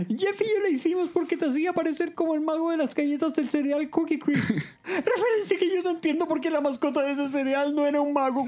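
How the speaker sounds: very high, hammed-up wailing; mock-crying character, pitched to the top; bursting into tears. A narrator's reading. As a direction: wail a tearful confession way up high. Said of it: "Troy estalla en llanto".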